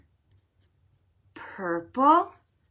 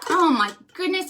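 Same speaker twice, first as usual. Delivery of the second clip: delighted exclamation; 8 semitones higher, 6 dB louder, faster than usual. Acted. Tasting the stew.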